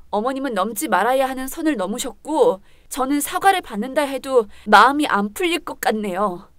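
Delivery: usual speaking voice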